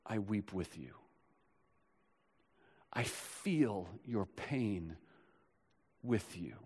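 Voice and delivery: a hush for comforting; tender. Acted. Tender voicing of Jesus.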